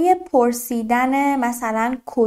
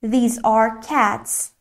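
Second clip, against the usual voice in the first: "these are cats" spoken very slowly, word by word; slow model sentence; 2 semitones lower, much slower than usual. Shown as a demonstration. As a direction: spell it out slowly for learners. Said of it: "اینها گربه هستند".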